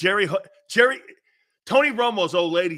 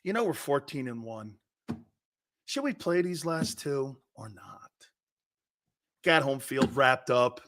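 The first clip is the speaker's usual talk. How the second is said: softer, lower, hesitant deliberation; imagined musing; 4 semitones lower, 5 dB quieter than usual. Retold or imitated. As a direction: weigh it up quietly, as if unsure. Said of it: "start talking like this".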